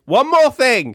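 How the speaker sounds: very high, piping falsetto; pestering woman. Acted. Woman stopping Andrew as he leaves, comic falsetto. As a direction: call out in a silly high voice. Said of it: "she's like".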